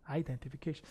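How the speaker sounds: identification said softly, trailing off; soft repeat. Shown as a demonstration. (조용히 다시 한 번)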